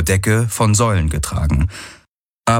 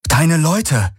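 much higher, alarmed cry at normal volume; alarmed realisation; 8 semitones higher than usual. A narrator's reading. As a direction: exclaim in sudden worry. Plötzliche Sorge um die Leute. (erschrockener, hoher Ausruf)

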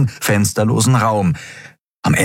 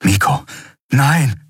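horrified cry; 6 semitones higher than usual. A narrator's reading. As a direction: call his name then break into a cry. Endriel sees boy fall. (entsetzter Ausruf, lauter werdend)

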